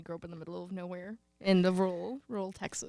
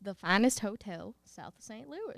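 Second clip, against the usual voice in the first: slow country twang on the vowels; stretched-out Southern twang, playful demo; 6 dB quieter, slower than usual. Shown as a demonstration. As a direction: drawl it in a Texas country accent. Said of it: "that's how you say that".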